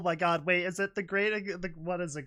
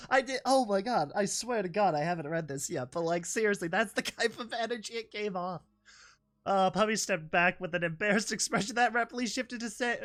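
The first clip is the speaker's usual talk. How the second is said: normal talking voice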